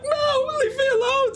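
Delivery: very high panicked wail; frightened woman. Acted. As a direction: cry out as a farmwife under attack. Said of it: "And the woman's like".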